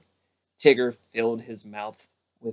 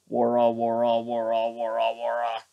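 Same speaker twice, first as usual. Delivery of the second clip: loud wara. A narrator's reading. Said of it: "With one loud".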